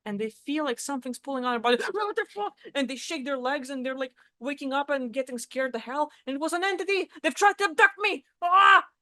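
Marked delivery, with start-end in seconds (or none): 1.8-2.6 high-pitched gasped shock; mock fright, pitch jumping up; startled awake. Acts waking up to being pulled. 6.3-8.9 high, loud, hammed-up terror; acted hysteria over an abduction; mock panic. Acts panic about alien abduction.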